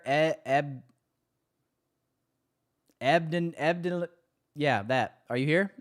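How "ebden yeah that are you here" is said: ordinary talk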